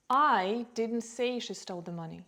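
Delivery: "I" punched, the rest falls away; strong "I", then a lighter "didn't say she stole the money"; stress on I. Shown as a demonstration. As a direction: hit the opening word "I" hard. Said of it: "maybe someone else said it".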